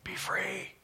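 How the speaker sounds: whispered, fading release; hushed "go be free". Real, not acted. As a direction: breathe it out like a blessing. Whispered blessing.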